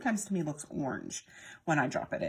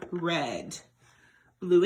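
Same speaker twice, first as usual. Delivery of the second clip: ordinary talk, nothing marked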